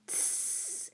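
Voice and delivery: a sharp breathy hiss through teeth; angry bug hiss. A narrator's reading. Giant bugs hissing angrily at Neil, breathy hiss. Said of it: "The giant bugs hissed angrily".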